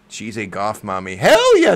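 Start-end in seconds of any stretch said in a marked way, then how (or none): 1.2-1.7 high, loud, emphatic burst; excited agreement. Real, not acted. Fan agreeing about her favorite wrestler, loud high cheer. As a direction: agree at full enthusiasm.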